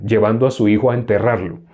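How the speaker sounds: normal talking voice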